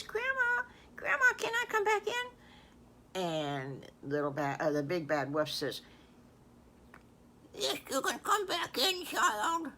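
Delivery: put-on the girl calling at the door and the wolf faking grandma; high storybook voices at the door; girl, then disguised wolf. Girl knocks, wolf poses as grandma.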